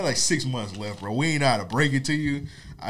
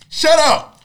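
high loud yelling snap; yelled shut up; 12 dB louder than usual. Retold or imitated. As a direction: scream it at the contestant. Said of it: "you like".

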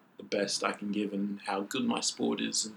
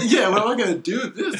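high, loud, laughing question; laughing bewilderment; 11 semitones higher, 8 dB louder than usual. Retold or imitated. Athlete joking about a gifted flag, laughing bewilderment. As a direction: play up the bewilderment through laughter.

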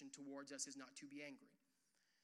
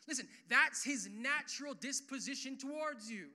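normal talking voice

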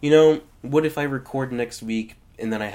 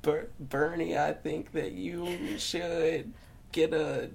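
Bill Clinton voice; 3 semitones higher, 8 dB quieter, slower than usual. Acted. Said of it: "I can do Bill Clinton".

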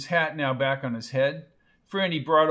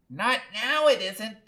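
high-pitched protest; contained yell of objection; mild yell; 8 semitones higher than usual. A narrator's reading. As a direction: snap back in a high voice. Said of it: "yelled Lulu".

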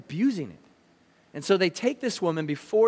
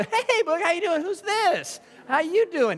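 cheery greeting; 11 semitones higher than usual. Retold or imitated. Friend pulling over to greet Boog and the girl, high eager hello. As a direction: greet him like an old pal you just spotted.

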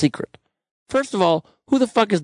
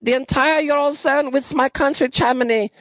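high, slow mock German Merkel; mock German accent; 11 semitones higher than usual. Host mocking Merkel refusing debt sharing, high German accent. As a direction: ham up a German chancellor accent. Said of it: "She's like".

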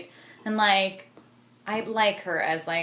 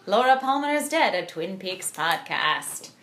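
dramatic announcer; 4 semitones higher than usual. Acted. Podcast outro teaser.

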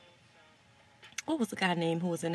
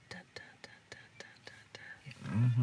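whispered dun-dun theme; 7 semitones lower, 5 dB quieter than usual. Pretend. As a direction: whisper a suspense sting, beat by beat. Mock theme after naming her film.

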